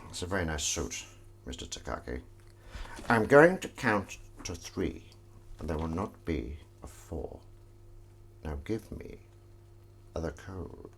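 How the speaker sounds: quietly menacing, just lightly done; lightly voiced villain. Quoting the Die Hard villain to chat, lightly menacing.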